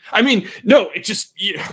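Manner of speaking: high, loud flustered protest; flustered denial. Acted. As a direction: catch yourself and protest too loudly.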